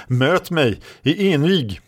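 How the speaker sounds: slow, high-pitched challenge; proclamation. A narrator's reading. Red Lady challenges the knight to a duel, slow high proclamation. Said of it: "förkunnade Röda Damen".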